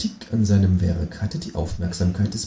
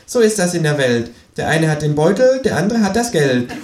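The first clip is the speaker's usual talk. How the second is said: higher kangaroo voice, smiling and smug; 5 semitones higher than usual. Acted. Kangaroo shrugging off the bill, smiling higher voice. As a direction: deliver the rhyme with a grin. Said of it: "sagt das Känguru lächelnd".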